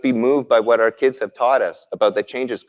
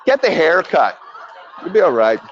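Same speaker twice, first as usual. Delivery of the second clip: laughing; 3 semitones higher than usual. Real, not acted. Laughing retold advice.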